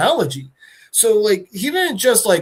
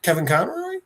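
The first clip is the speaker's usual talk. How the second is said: usual speaking voice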